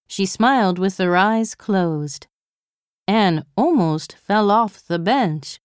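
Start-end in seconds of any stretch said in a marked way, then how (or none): none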